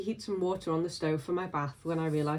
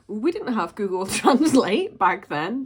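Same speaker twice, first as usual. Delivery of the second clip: laughing; 4 semitones higher, 9 dB louder, slower than usual. Real, not acted. Laughing at old Italian mistakes.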